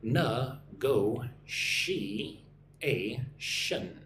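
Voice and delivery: na-go-she-a-tion split into slow syllables. Shown as a demonstration. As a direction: spell out na-go-she-a-tion with the sh sound. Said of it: "Gotta get those sh sounds".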